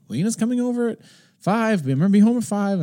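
high-pitched wife's reminder, imitated; mock nagging in a high voice. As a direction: remind them pointedly, voice pitched well up.

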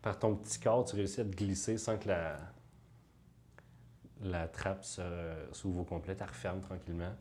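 soft, hushed scene setting; game master narration. Acted.

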